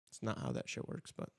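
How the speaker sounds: whispered aside; breathy muttered correction; whispering. Real, not acted.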